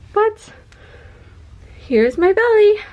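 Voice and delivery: bright, loud, high-pitched show-off; excited reveal. Real, not acted. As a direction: present the bump with a burst of excitement. Showing off pregnant belly.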